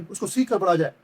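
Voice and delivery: ordinary delivery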